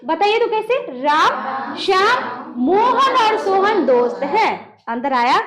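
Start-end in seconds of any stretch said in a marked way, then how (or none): 1.0-4.8 slow, with a pause after Ram and after Shyam; pausing at commas. Shown as a demonstration. Teacher showing the right pauses between the names. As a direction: let each comma breathe. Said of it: "तो यहाँ पे ऐसे बोलेंगे".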